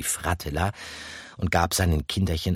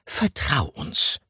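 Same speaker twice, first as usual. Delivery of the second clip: murmured; 7 semitones higher, slower than usual. A narrator's reading. Gruyère murmurs to comfort Pikandou. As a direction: murmur reassuringly. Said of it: "nahm sein Pfötchen und murmelte".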